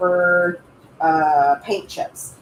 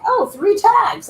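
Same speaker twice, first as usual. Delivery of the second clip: mock realization; 13 semitones higher, slower than usual. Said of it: "I'm like".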